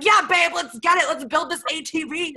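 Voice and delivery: high-pitched, hammed-up eager partner; mock enthusiasm. Girlfriend pretending to share boyfriend's garage hobby, mock enthusiasm. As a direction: fake being thrilled to join in. Mocks herself as keen partner.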